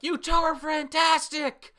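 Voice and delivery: put-on high, loud, gushing girl's voice; Vanessa's excitement. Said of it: "and Vanessa's like".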